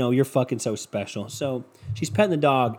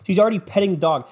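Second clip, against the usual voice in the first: ordinary talk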